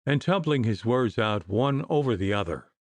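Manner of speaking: usual speaking voice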